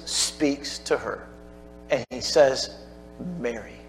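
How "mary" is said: very soft, gentle calling of her name; tender call. Acted. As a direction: speak her name softly, full of love. Jesus calls Mary by name.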